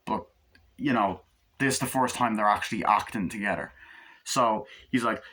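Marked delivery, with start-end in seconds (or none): none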